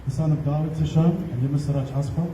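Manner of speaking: normal talking voice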